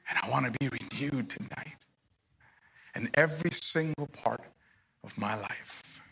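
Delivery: hushed prayer. Real, not acted. Leads congregation in prayer.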